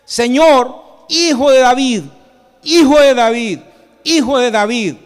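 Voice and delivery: loud, high repeated cry; full-voiced preacher acting desperate shouts. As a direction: call out insistently over a crowd. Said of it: "empezaron a gritar más fuerte".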